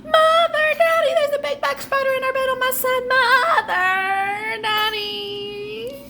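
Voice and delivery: very high, loud screaming call for the parents; a frightened girl runs screaming to her parents; screaming child. Acted. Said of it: "went screaming into her mother and father's room".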